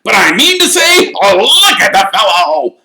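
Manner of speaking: high, boisterous mocking shout; shouting Sir Patrick. A narrator's reading. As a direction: bellow teasingly to the whole crowd. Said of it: "shouted Sir Patrick's head".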